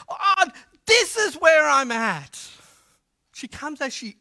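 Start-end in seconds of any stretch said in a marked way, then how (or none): 0.1-2.4 slow, high, loud cry to God; acted impassioned prayer; anguished plea. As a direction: pour it out to God, drawn out. Acts Hannah pouring out her soul.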